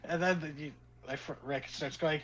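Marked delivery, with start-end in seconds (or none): none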